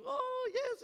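put-on very high, tiny old-lady voice; Rosie's high voice. Pastor imitating Rosie's high little voice for the church. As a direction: squeak it up like sweet little Rosie. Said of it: "talked to this really high-pitched little voice".

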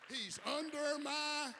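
high, slow, forceful repeat; loud cueing call. Real, not acted. Preacher demanding church finish the line, loud call. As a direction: belt it out and leave it hanging. Repeats cue louder after "what?.

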